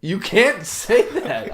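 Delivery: high, slow mock protest; scolding manager. Manager to drunk employee, mock scandalized. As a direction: stretch it out as a half-laughing reprimand.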